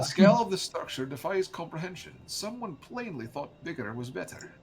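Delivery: lightly voiced scholar. Retaking a game line for a co-player, in a lightly voiced scholar role. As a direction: read it like a learned game character.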